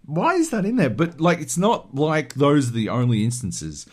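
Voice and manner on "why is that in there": baffled. Retold or imitated. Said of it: "I'm like".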